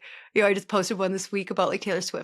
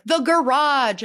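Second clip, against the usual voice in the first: high and loud, slowly savoured; excited fan; 7 semitones higher, 7 dB louder, slower than usual. Retold or imitated. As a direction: say it like an eager fan. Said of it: "And they'll, like, reference".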